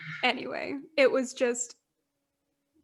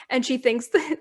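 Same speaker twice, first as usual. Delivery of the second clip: normal talking voice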